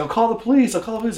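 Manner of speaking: high-pitched mock panic; frantic, high imitation; freaking out. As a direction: imitate a panicked threat, loud at first. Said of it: "he started freaking out".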